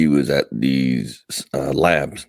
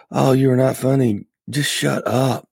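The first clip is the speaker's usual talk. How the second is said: mock retort; 9 semitones higher than usual. Imagined retort to herself.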